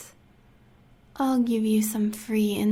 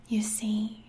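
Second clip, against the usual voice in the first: usual speaking voice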